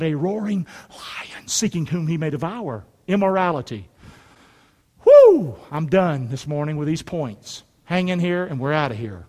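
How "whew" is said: high, loud "whew" of relief. Real, not acted. Preacher wrapping up his points, loud whew. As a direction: let out a sharp high whew.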